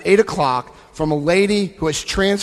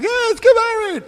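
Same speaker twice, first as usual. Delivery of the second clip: far higher, a breezy "let's get married"; mock casual American. Mocking his casual proposal to the Italian family, much higher. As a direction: send up the careless American. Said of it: "one of these American things".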